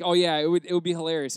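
loud, insistent mock enthusiasm; eager reassurance. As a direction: sell the idea hard, like an egging-on friend. Friends egging him on.

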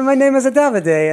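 high-pitched, hammed-up Italian accent; Davide's Italian accent. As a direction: redo the teasing Italian imitation in one go. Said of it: "with the right accent".

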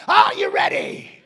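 high, loud, dragged ready call; imitating a preacher's shout; Alan's preacher call. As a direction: fire up the crowd like Alan. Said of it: "That's how Alan did".

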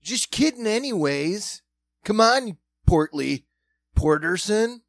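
hammed-up taunting bully; mock bully. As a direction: laugh off the insult like a jerk. Mocking the bully's fake apology.

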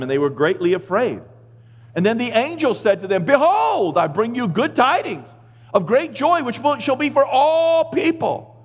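preacher reading Luke aloud, lifted and solemn; scripture reading. Acted. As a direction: proclaim the passage to the congregation. Reads the Christmas scripture.

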